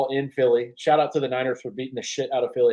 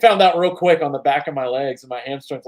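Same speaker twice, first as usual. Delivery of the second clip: ordinary delivery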